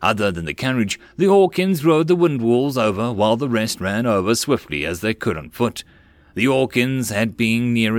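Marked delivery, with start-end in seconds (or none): none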